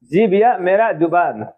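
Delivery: ordinary talk, nothing marked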